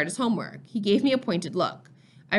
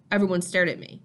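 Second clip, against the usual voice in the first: usual speaking voice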